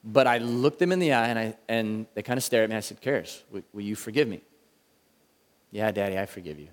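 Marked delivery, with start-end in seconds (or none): 3.0-4.4 low, soft fatherly plea; quiet plea. Retold or imitated. Father quietly asks his daughter Karis, soft plea. 5.7-6.7 soft, low little voice; quiet child reply. Retold or imitated. Daughter answers her father softly, quiet child reply.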